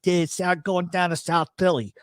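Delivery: mock South Philly vowels; South Philly accent. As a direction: slip into exaggerated South Philly. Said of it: "it's the same thing".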